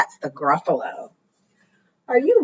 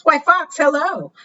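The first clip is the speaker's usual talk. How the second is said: high, singsong mouse voice; storybook mouse saying hello; mouse's friendly greeting; 8 semitones higher than usual. Acted.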